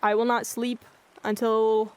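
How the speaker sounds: ordinary talk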